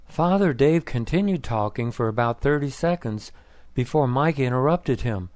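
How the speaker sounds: usual speaking voice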